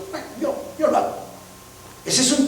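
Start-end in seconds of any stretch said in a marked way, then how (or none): 0.1-1.1 desafiante imitado. Retold or imitated.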